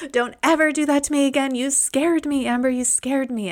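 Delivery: high, fast mock scolding from mom; mother chiding her child after a fright; relieved mom repeating the scolding. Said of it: "She's like".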